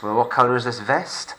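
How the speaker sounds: usual speaking voice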